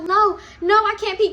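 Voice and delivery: high, loud panicked whine; panicky. Retold or imitated. She's freaking out, panicking.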